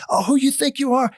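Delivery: high-pitched mock yell; imitated angry challenge. Screaming man turns on the storyteller, mock angry. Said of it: "he's screaming".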